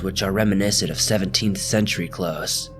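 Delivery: usual speaking voice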